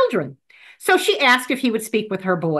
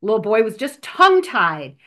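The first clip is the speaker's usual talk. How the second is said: ordinary talk, nothing marked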